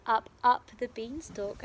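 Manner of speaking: plain reading voice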